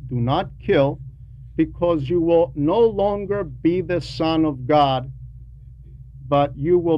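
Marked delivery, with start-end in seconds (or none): none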